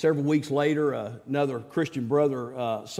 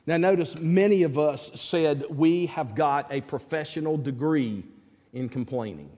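ordinary talk

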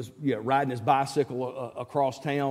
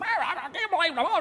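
much higher, laughing mock complaint; mock exasperation. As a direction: play the whiny griper, half-laughing. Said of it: "running around and going".

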